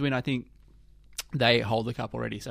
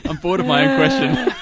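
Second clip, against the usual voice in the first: words spilling out through a real laugh, louder; laughing at herself, half embarrassed; 7 dB louder than usual. Real, not acted. Laughing at her own yawning.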